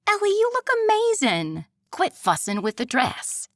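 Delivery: high, bright friend's voice; bubbly girlfriend voice. Acted. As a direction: gush like an excited best friend. Friend Evie voiced, upbeat.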